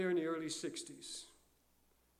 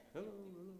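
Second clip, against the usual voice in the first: much softer, higher echo; imitated echo; 4 semitones higher, 12 dB quieter than usual. Preacher showing the echo coming back, faint echo. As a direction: answer faintly like a distant echo. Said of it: "the echo comes back".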